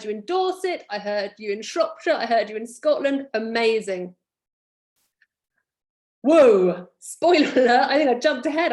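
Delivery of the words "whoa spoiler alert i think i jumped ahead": loud burst of excitement; higher, louder, excited. Real, not acted. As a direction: blurt it out, caught up in the moment. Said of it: "I was so excited".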